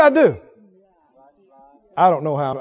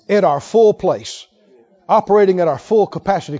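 ordinary talk, nothing marked

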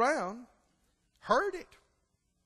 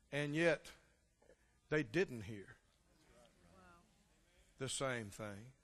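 much softer and lower, drawn down; hushed preaching; 8 semitones lower, 11 dB quieter, slower than usual. Real, not acted. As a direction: let it sink to a hush. Preacher drops voice for effect.

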